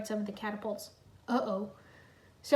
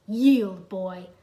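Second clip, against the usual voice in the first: hiss; 2 semitones lower, 6 dB louder, much slower than usual. A narrator's reading. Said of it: "Crux hissed".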